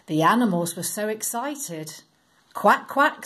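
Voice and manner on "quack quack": high, loud duck quack. Acted. Ducks excited to board the ark, high loud quacking. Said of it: "said the ducks".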